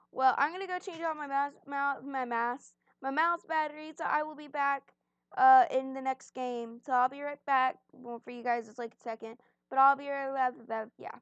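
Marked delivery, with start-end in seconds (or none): none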